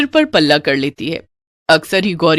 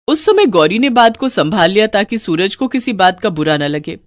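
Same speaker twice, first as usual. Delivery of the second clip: ordinary delivery, nothing marked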